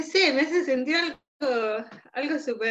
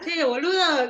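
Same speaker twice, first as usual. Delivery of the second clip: Argentine slang; 5 semitones higher, 4 dB louder than usual. Retold or imitated. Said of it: "vuelvo a ser así, neutra".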